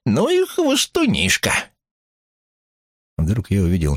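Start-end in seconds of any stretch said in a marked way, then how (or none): 0.0-1.5 slow, very high-pitched cry; old man's shout back. A narrator's reading. Uncle Andrews scoffs back at his neighbour, high drawn-out cry. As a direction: draw the exclamation out in a high voice. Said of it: "закричал в ответ дядюшка Эндрюс".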